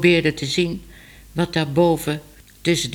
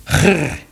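growl; 5 dB louder than usual. A narrator's reading. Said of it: "zei Mars".

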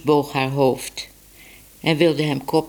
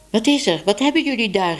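higher-pitched alarmed question; calling out; 6 semitones higher, faster than usual. A narrator's reading. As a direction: call out a demanding question, pitched up. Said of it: "riep Tibbe".

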